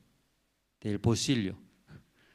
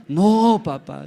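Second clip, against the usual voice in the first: put-on high, loud child's protest; pleading child; 7 semitones higher, 11 dB louder, slower than usual. Son protesting to father, high loud plea. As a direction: cry out no like a scared boy.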